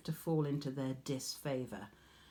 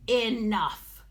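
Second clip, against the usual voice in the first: higher, louder angry cry; 5 semitones higher, 8 dB louder than usual. A narrator's reading. Goddess silencing the mocking mortal, furious cry. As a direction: cut her off hard. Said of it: "the goddess cried".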